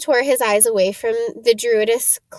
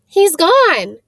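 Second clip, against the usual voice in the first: surprised cry; 7 semitones higher, 7 dB louder than usual. Acted. Harry startled by vanished picture.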